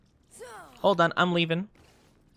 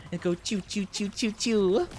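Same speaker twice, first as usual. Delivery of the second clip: lightly voiced choo gunfire noises; gunshot sound effects; 4 semitones higher, 4 dB quieter than usual. Shown as a demonstration. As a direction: demo the gun noises with your mouth. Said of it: "I was actually the voice actor for the choo choo choo sound effects".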